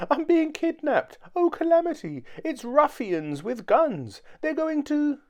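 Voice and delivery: very high, loud hysterical squeal; terrified scream. A narrator's reading. Mister Jelly imagines ruffians with guns, screaming. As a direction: squeal as if being dragged away. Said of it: "he screamed".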